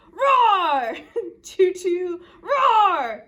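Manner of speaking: loud, high roars round a train call; playful, booming roars; dinosaur roaring. Acted. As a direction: roar out the letter like a dinosaur. Reads Dinosaur's roared letter.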